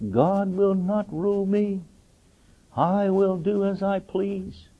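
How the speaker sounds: slow, high, defiant vow through gritted teeth; a rebellious teenager defying God, slow and high; defiant teen. Retold or imitated. Preacher voicing a teenager defying God, slow and defiant. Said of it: "you gritted your teeth".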